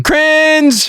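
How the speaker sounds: high-pitched squeal of disapproval; cringing outcry. Real, not acted. Host reacting to a bad joke, high-pitched cringing. Exclaims in disgust at the special.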